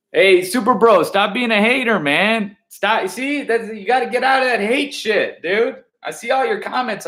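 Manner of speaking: high, heated, fired-up scolding; worked-up rant. Real, not acted. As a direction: get worked up and call him out. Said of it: "I got a little crazy there".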